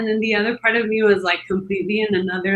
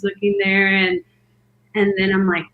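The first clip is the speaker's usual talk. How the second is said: ordinary delivery, nothing marked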